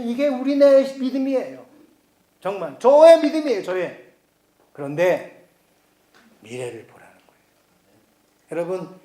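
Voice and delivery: ordinary delivery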